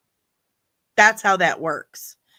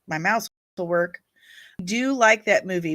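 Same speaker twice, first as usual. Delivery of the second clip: ordinary talk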